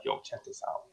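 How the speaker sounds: secretive whisper. Retold or imitated. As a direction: hush it like sharing contraband. Friend sneaking out weed.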